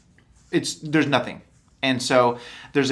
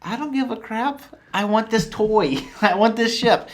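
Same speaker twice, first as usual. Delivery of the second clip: put-on high, whiny spoiled-child Vader; mock Vader; 8 semitones higher than usual. Vader demanding the Falcon, whining like a spoiled kid. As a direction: whine like a kid grabbing a toy. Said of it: "He's like".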